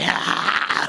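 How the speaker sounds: villain's cackle. Acted. Imitates Blackbeard's laugh.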